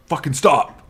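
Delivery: sudden acted burst of anger; angry outburst. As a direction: imitate someone losing his temper. Man snaps at prankers.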